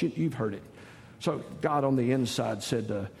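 usual speaking voice

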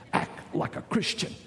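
whispered scolding, as if under the table; acted covert whisper; hushed warning. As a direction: hush someone urgently without being overheard.

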